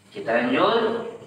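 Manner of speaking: ordinary talk, nothing marked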